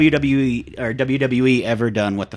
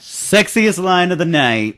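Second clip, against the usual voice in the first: slow, higher announcer flourish; mock announcer; 5 semitones higher, 4 dB louder, slower than usual. Co-host announcing the show's new joke award, mock announcer. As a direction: stretch it out like a segment title.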